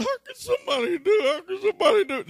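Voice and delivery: high, loud, tearful wail; near tears. Retold or imitated. Preacher retelling his stolen haircut money, tearful and high. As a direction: whimper like a hurt little boy. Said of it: "I was on the verge of tears".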